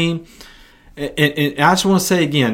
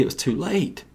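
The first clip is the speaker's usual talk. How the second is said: hushed; 3 semitones higher, 9 dB quieter than usual. Shown as a demonstration. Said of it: "repeated line".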